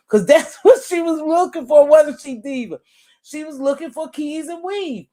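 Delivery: high, loud laughing lines; laughing hard through the words. Real, not acted. Laughing while telling family about the weave.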